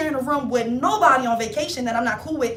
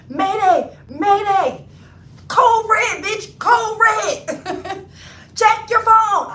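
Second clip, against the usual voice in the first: high, loud frantic alarm calls; mock panic; 9 semitones higher, 9 dB louder, much slower than usual. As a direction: scream mayday like the plane is going down. Panicked texting cousin.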